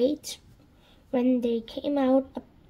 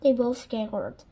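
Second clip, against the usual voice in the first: ordinary delivery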